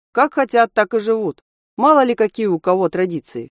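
snapped, dismissive reply; curt cut-off. A narrator's reading. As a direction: shut the discussion down firmly. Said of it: "отрезал смотритель".

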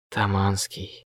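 soft whisper into an ear. A narrator's reading. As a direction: whisper to wake him gently. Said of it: "зашептал я Косте на ухо". (шёпотом на ухо)

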